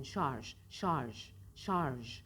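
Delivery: şarj, şarj, şarj drilled with a zh ending; repeated drill. Shown as a demonstration. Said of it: "Sürekli tekrar edin".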